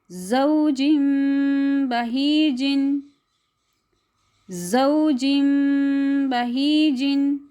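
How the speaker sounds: ज़ौजिम बहीजिन with tanween as nasal म before ब, drawn out; slow recitation. Shown as a demonstration. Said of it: "पढ़ के सुना दूँ". (धीमी तजवीद क़िराअत)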